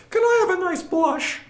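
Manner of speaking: put-on high, quavery old granny voice; old grandma voice. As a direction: play a sweet little grandma ordering wine. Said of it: "Old grandma voice".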